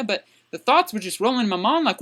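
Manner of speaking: ordinary talk, nothing marked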